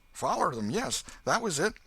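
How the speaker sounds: put-on a breathless ragged country boy; Huck the boy. A narrator's reading.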